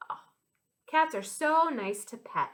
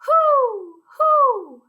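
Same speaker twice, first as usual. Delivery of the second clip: high, loud owl hoot; 13 semitones higher, 11 dB louder than usual. Pretend. Teacher showing children what an owl says, high hooting. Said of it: "Hear that funny sound?".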